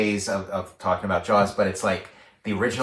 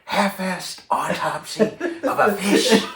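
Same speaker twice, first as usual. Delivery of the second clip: mocking movie line; 9 semitones higher than usual.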